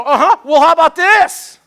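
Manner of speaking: spring the trap with a grin; smug comeback. Retold or imitated. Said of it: "And you go".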